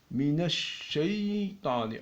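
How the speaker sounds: "minash shaytani" slow, shin held long; slow doubled shin. Shown as a demonstration. Teacher to students, "minash shaytani" slow with the shin held. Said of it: "c'est écrit de cette manière-là".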